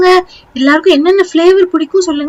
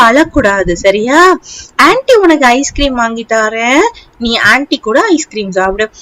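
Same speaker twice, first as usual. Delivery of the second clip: soothing; 8 semitones lower than usual. Acted. Aunt comforting crying child.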